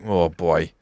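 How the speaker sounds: put-on low, soft "oh, boy" of dismay; retold dismay. Fan recalling hearing a player's voice, muted dismay. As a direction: imitate your own sinking reaction, low and quiet.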